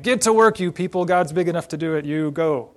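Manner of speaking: finger-wagging scolding. Acted. Preacher imitating a scold to his people, loud mock finger-wagging. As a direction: wag a finger at them, loudly. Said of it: "a good old finger wagging".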